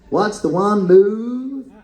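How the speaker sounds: badly faked American accent, drawn out slowly; slow, terrible stab at an American accent. Shown as a demonstration. Comedian tries an American accent on the audience, slow badly faked American. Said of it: "I tried to do an American accent".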